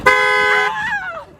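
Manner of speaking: very high, held "ah"; showy cry. Pretend. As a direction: squeal it out with the trick. Performer voicing her trick.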